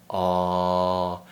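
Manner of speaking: long open ah, tongue far back. Shown as a demonstration. Teacher models the vowel for learners, long open ah. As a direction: open the throat on "Ah", tongue pushed down. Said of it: "you'll want to do the ah sound".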